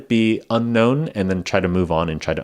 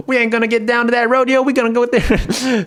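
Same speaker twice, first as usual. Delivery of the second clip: very high, loud cartoon Southern accent; 4 dB louder than usual. Shown as a demonstration. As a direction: ham up a squawking Southern accent. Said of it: "it's called a Southern accent".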